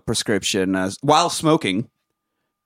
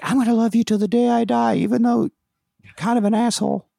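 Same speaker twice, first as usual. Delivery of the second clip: mock lovesick girl; 10 semitones higher than usual. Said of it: "He's like".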